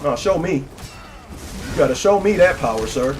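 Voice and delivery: ordinary talk, nothing marked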